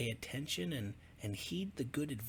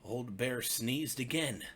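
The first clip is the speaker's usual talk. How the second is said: ordinary delivery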